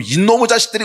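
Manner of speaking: furious outburst at the enemy; enraged soldier's cry. Retold or imitated. A soldier cursing the enemy after his comrade falls. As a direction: spit it out in sudden rage.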